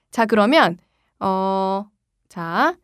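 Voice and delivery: ordinary delivery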